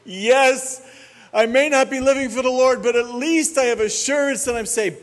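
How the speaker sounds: high, loud, smug voice of a careless believer; mock backslider. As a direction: lampoon the backslider's cheerful confidence. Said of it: "I'll get the answer back".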